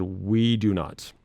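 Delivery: ordinary talk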